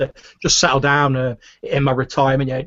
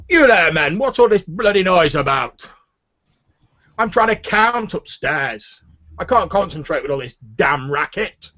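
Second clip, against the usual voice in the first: put-on higher-pitched, irate pompous old grumbler; blustering fat official; 6 semitones higher, 3 dB louder than usual. Fat man with a cane scolds two guards over noise, indignant. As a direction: complain at the men like an interrupted official.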